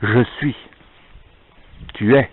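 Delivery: normal talking voice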